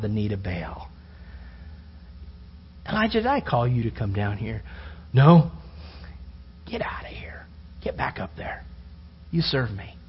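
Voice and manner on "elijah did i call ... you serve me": hushed divine rebuke. Retold or imitated. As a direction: lower and much softer, partly whispered. God rebukes Elijah in cave.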